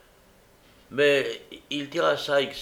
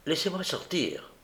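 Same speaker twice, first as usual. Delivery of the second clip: higher-pitched, restrained plea; urgent insistence; 6 semitones higher, 4 dB quieter than usual. A narrator's reading. Young woman begs to be let out, insistent. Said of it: "avec la plus vive insistance".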